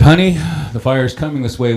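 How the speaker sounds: a touch of alarm to wake her; urgent. Retold or imitated. Waking his sleeping wife about the fire, urgent call.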